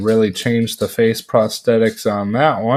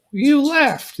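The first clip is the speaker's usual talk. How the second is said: much higher cartoon bear voice, half-joking; Pooh voice. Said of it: "the Pooh voice".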